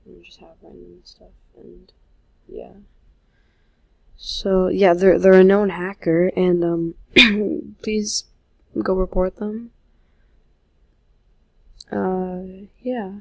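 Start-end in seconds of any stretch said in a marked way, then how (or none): 4.2-9.7 louder, pressing warning; rally viewers to act now; urgent loud appeal. Real, not acted. Telling viewers to report a hacker, loud and urgent.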